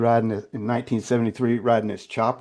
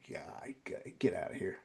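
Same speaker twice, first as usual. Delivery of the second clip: whispered, very soft brush-off; muttered dismissal; 5 semitones lower, 17 dB quieter than usual. Retold or imitated. Man dismissing what people say, whispered brush-off. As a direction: wave it away almost without voice.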